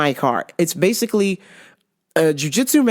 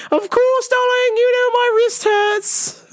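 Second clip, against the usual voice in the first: high, drawn-out mock mother; imitated mom. Mom handing over the knot-tying, high imitation. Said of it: "She's like".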